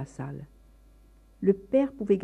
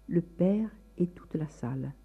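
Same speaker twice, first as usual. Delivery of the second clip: ordinary delivery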